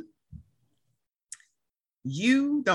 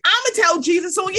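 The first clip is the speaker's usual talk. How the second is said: high, fast, insistent threat; escalated mock threat; 8 semitones higher, 4 dB louder, much faster than usual. Threatening to tell Jesus, high rushed mock outburst. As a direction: repeat it higher and much faster.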